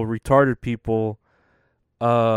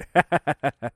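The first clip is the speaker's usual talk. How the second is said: laughing; 3 semitones higher than usual. Real, not acted. Laughs at own tired joke.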